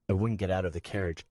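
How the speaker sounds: plain reading voice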